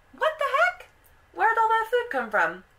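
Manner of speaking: high, loud shriek of disbelief; acted-out shocked wife, very high and loud; astonished. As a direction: squawk it out, utterly amazed. Wife amazed at the food.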